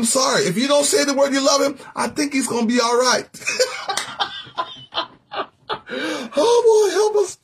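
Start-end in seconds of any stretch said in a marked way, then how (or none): 3.3-5.0 high wheezy laughter; helpless high-pitched laugh. Real, not acted. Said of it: "I'm not laughing at you". 6.3-7.4 very high voice after laughing; high, giddy plea; amused, laughing. Real, not acted. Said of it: "I'm not laughing at you".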